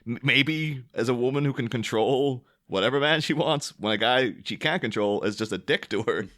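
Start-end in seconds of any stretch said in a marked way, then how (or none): none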